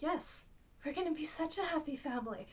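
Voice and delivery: a light touch of sinister sweetness; evil queen mode. Retold or imitated. Regina telling Snow about the family, a hint of evil queen. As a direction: say it like a smile hiding menace. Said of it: "Just fully gone into evil queen mode".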